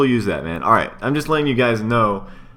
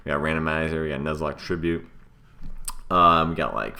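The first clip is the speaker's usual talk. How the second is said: usual speaking voice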